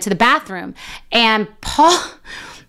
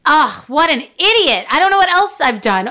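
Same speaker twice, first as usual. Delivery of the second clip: ordinary talk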